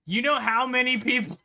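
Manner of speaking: loud, high-pitched rant; incredulous outburst. Real, not acted. Streamer to friends, pitching dental for citizenship, loud disbelief. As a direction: blurt it out, voice jumping up.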